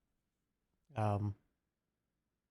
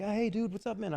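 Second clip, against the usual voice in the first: friendly fan; 7 semitones higher, 3 dB quieter than usual. Retold or imitated. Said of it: "they're like".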